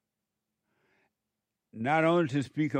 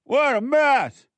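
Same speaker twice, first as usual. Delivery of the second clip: very high, very loud, slow cry; exclaiming shout; 12 semitones higher, 9 dB louder, slower than usual. Real, not acted. Preacher to congregation about their confusion, slow loud outcry. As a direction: belt it out in disbelief.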